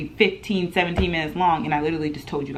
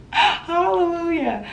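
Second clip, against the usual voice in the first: high, drawn-out hallelujah; relieved rejoicing; 11 semitones higher, much slower than usual. Acted. Vlogger learning it was roommate's mother, playfully relieved.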